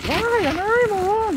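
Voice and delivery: very high mock little-girl pout; crying girl talking back to the old man, very high pouting voice; childish sulk. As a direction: squeak it like a sulking child. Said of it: "みたいな感じで".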